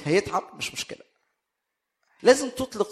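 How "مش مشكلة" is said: breathy throwaway whisper; whispered aside. Real, not acted. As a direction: drop to a whisper, shrugging it off. Aside after hoarse throat remark. (همسة جانبية خفيفة)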